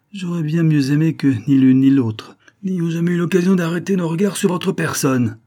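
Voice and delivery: high, loud, vehement outburst; impassioned and fierce, barely held in; passionate, contained violence. A narrator's reading. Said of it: "ton passionné avec une violence contenue".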